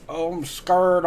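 lightly exaggerated DC-style accent; mock regional accent. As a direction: imitate an out-of-town accent on "scared". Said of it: "it don't sound like regular English".

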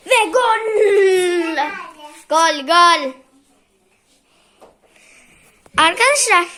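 football commentator. Pretend. Celebrating goal like commentator.

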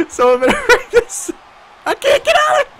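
high laughing plea. Real, not acted. Repeated higher.

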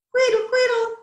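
high-pitched singsong bird call; bird-call whistle imitation. A narrator's reading. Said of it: "it called".

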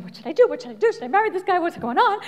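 frantic, rising what should I do; a touch of retold frenzy; mild mock panic. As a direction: fret it out like you're losing it. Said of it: "going about like a crazy person".

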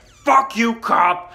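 loud, high burst of defiance; acted angry retort. Answering the cop's request to go inside, angry. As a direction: shout the insult at him, hammed up.

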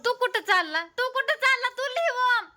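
very high, loud voice; high-pitched calling out. Real, not acted. Mother asks child where he's going and orders him to write, high loud calling.

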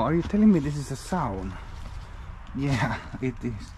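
ordinary talk

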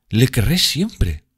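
high, drawn-out vow of love; ardent exclamation. A narrator's reading. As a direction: exclaim it slowly, soaring up in devotion. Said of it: "exclamó Sibyl". (exclamación apasionada, aguda y lenta)

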